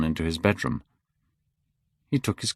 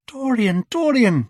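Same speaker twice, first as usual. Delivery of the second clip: much higher, desperate calling; pleading cry; 4 dB louder than usual. A narrator's reading. Sybil begging Dorian to understand, a high pleading cry. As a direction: cry his name out imploringly. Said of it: "she cried".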